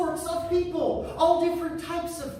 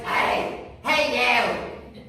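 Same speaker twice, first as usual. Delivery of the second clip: gravelly, aggressive; much slower than usual. Retold or imitated. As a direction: call out roughly, aggressively. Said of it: "a gravelly, aggressive voice".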